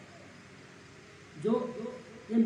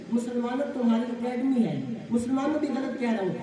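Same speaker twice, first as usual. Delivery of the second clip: usual speaking voice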